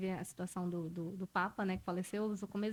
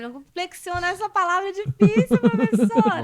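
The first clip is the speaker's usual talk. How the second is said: loud, high mock complaint to the teacher; imitating a pupil; 9 semitones higher, 15 dB louder than usual. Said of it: "que a aluna tentou". (imitando aluna reclamando alto e agudo)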